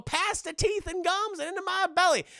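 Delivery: high, sing-out cartoon gobbler; Shaggy. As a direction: relish it in a goofy high voice. Voicing Shaggy about to eat.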